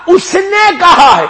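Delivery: high, loud cry across the court; shouting in court. Retold or imitated. Maytham shouting to Mukhtar before the court, high loud cry. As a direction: call out defiantly to Mukhtar. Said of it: "دربار میں چیخ کے کہ".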